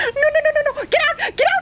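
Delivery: high, loud, laughing panic; panicked yelling. Real, not acted. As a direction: yell it half laughing.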